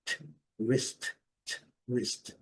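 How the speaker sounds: a bare voiceless "t", then "wrist"; isolated final T. Shown as a demonstration. Teacher isolating the last sound for learners, crisp T. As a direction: release the "t" alone, then end "wrist" on it. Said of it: "E o T".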